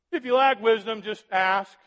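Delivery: ask, shouted high and loud; excited shout. Real, not acted. Preacher excitedly shouting to the congregation. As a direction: burst out with joy at the promise. Preacher exclaims scripture joyfully.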